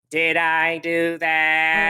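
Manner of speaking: drawn-out sitcom catchphrase voice; put-on voice, very slow; nineties TGIF sitcom. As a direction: stretch every word out like a goofy sitcom catchphrase. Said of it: "embody my, uh, T G I F from in the nineties".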